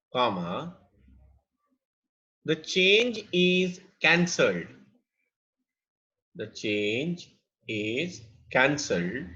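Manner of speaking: slow, spaced-out classroom dictation; measured delivery for note-takers. Real, not acted. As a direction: dictate phrase by phrase with long pauses. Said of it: "कितना slow बोल रहा हूँ".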